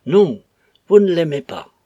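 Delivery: fierce shouted accusation; savage cry. A narrator's reading. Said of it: "cria-t-il avec une violence sauvage".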